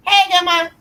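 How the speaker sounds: put-on high, loud little girl voice; little girl greeting. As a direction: chirp it like a small granddaughter. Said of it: "here come her daughter talking about".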